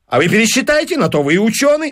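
high-pitched, pushy retort; brisk dismissal. Acted. Businessman answering the professor's doubts, pushy. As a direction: brush the objection aside. Fines brushes off the objection.

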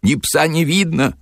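high, panicky exclamation; mildly barked alarm at normal volume; horrified bark. A narrator's reading. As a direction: yelp it out in acted horror. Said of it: "в ужасе пролаял".

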